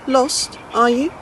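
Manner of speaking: put-on a knowing old lady asking gently; lightly voiced old lady.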